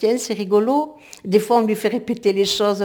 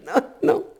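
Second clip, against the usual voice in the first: laughing; 4 semitones higher than usual. Real, not acted. Laughs about own accent.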